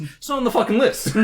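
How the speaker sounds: high, loud, incredulous outburst; mock outrage. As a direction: explode comically at the discovery. Acting outrage at missing game.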